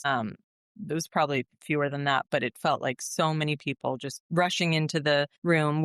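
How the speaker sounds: normal talking voice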